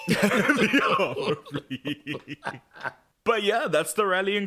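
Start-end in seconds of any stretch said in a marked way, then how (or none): 0.0-2.4 drawn out slowly through laughter; laughing echo. Real, not acted. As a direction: repeat it while cracking up. Laughing echo of co-host's joke.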